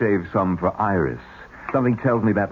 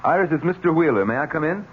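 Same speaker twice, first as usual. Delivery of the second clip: high, raised call through the door; 9 semitones higher than usual. Acted. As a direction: call to her in the next room. Calling through a closed door.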